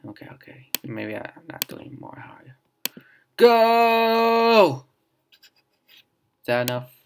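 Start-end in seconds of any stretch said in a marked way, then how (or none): 0.1-2.5 whisper. Real, not acted. Muttering while concentrating. 3.4-4.8 a high, loud yell of go; shouted go. Real, not acted. Player yells at the stuck panda, a sudden high shout.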